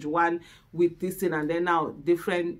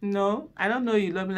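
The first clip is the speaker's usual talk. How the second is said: naughty teasing. Retold or imitated. Wife teasing her loving husband, playful. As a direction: tease him back, pretending not to know. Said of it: "when I'm actually feeling naughty".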